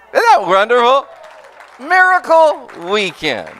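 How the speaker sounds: joyful sermon exclaim. Real, not acted. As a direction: celebrate it to the crowd. Preacher celebrates before congregation.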